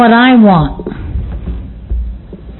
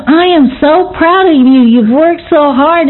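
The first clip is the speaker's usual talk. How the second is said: higher-pitched, drawn-out imitation of heartfelt praise; imitating a proud friend, each word leaned on; emphatic retold praise; 7 semitones higher than usual. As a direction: repeat the compliment with emphasis, voice lifted high. Retelling sponsee's praise, repeated emphatically.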